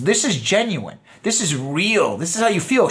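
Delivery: usual speaking voice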